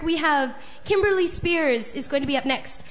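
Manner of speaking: ordinary talk, nothing marked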